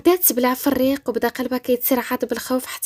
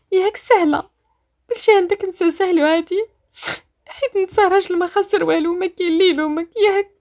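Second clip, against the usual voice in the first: higher, louder acted reproach; reproachful; 6 semitones higher, 4 dB louder than usual. Rania reproaches Badr for having it easy, reproachful. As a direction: throw it at him accusingly.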